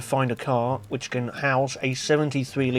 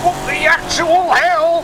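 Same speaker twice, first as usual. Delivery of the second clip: high, loud, slow disbelief; shocked outburst; 14 semitones higher, 6 dB louder, slower than usual. Real, not acted. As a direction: blurt out real astonishment. Car misbehaves again.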